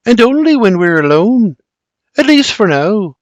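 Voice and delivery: high, teasing Scottish lilt; sultry Scottish. A narrator's reading. Mary saunters away from William, flirty and Scottish. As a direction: toss it back in a flirty Scottish voice. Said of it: "with her sultry Scottish tone".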